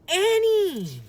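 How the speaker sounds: high loud call across the hill. A narrator's reading. Jack calling after Annie across the hill, loud. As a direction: call her name loudly.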